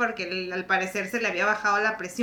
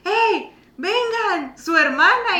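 mock calling out; 9 semitones higher, much slower than usual. Strangers calling the sisters outside.

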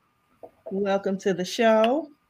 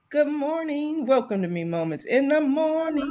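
radio host; 6 semitones higher than usual. Acted. Opening the show like hosts.

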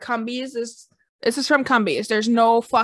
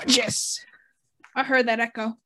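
ordinary delivery

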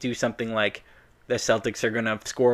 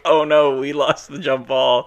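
oh no, drawn-out and louder; mock dismay; 3 semitones higher, 6 dB louder, slower than usual. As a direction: pretend disappointment, hammed up. Mocking a team losing tip-off.

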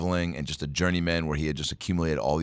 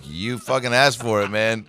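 higher, louder retold retort; mock indignation; 5 semitones higher, 5 dB louder than usual. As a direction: throw it back, mock-exasperated. Retold office reply, indignant.

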